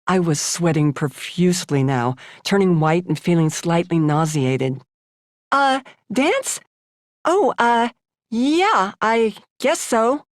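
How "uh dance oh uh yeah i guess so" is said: high, hesitant nervous stammer; unsure and stalling for time; flustered. Acted. Girl asked if she can dance, high nervous hesitation.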